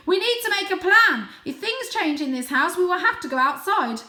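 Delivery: high, loud urging; urgent elder. Acted. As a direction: push through the noise. Elder Paul urges a plan.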